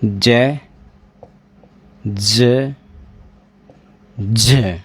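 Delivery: ज as a short, voiced "juh"; isolated voiced ज sound. Shown as a demonstration. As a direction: say ज on its own, buzzed and separate. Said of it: "तो उसका उच्चारण आपको".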